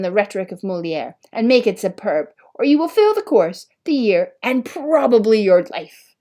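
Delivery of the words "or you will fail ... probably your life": loud, high demand; teacher demanding the paper from Oliver, loud and stern. A narrator's reading. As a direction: press the threat home. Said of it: "he demanded".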